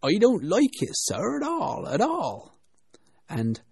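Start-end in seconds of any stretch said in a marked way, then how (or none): none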